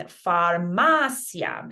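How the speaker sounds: "farmacia" slow, stressed on MA, ending in one-syllable "sya"; final diphthong. Shown as a demonstration. Teacher models diphthongs, stress on MA in "farmacia". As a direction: stretch MA in "farmacia" and glide through the last vowels. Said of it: "counting these last two letters as one vowel".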